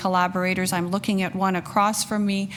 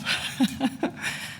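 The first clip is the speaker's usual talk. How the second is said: breathy laugh, holding back tears; teary laugh; 5 semitones higher, 3 dB quieter than usual. Real, not acted. Official overcome while thanking staff, soft tearful laugh. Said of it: "I think I better stop".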